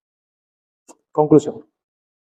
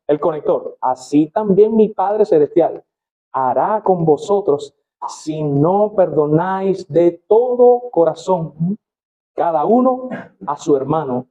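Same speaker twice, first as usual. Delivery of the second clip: slow, solemn Bible reading with rising emphasis; measured pulpit reading of a parable; scripture reading; 4 semitones higher, slower than usual. Acted. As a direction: read the parable like a preacher at the pulpit. Reads parable aloud from scripture.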